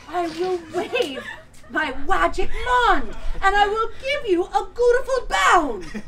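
slow, high and loud, laughing; grand magic spell. Acted. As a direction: declare the spell theatrically. Fairy godmother casts spell.

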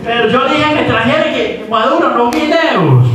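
put-on high childish whine, protesting; a simple boy insisting he was right; simpleton voice. Acts the son answering in a high voice. (voz aguda de bobo protestando)

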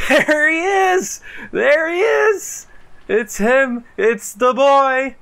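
high, loud, slow joyful shouting; excited shout. Real, not acted. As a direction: greet him like a hero. Favorite character appears.

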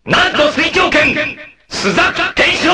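high, full-throated shouted technique name; battle cry. Acted. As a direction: roar the move's name as you strike.

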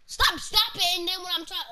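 high, loud yell; shouting. Real, not acted. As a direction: yell at your friend to stop. Friend riding on board.

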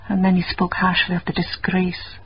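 ordinary delivery

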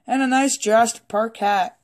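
usual speaking voice

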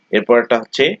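ordinary talk, nothing marked

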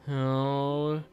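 ordinary talk, nothing marked

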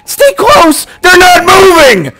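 loud, high yell at stuck teammates; yelling at teammates who won't move; frustrated shout. Real, not acted. Gamer yells at frozen teammates, frustrated shout.

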